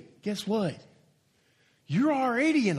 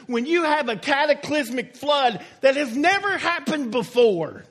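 rising preacher's cadence, driving each line home; preaching; 5 semitones higher, 3 dB louder than usual. Acted. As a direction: preach it to the congregation. Preacher building to full force.

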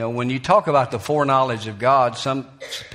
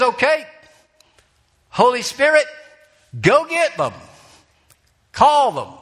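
high, loud booming command; God sending out the Holy Spirit to call people, commanding; 11 semitones higher, 5 dB louder than usual. Acted. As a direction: ring it out like God giving orders. God sends the Spirit, voiced.

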